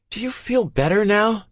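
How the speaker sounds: high, loud, cold anger; grinding out. A narrator's reading. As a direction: force it out through cold fury. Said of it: "she grinds out".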